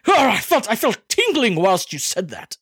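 hammed-up thrilled British gent, high and settling; jaunty British man. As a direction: overplay delight in a British accent. Continues British gent act.

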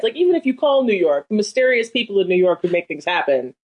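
ordinary talk, nothing marked

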